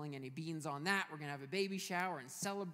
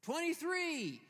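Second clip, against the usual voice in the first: high, drawn-out call across a cell block; raised, high shout in a joke; acted yell; 10 semitones higher, 4 dB louder, slower than usual. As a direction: yell out the number like an inmate. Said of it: "he hears somebody yell out".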